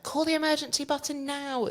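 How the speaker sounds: panicked order. Retold or imitated. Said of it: "she panicked".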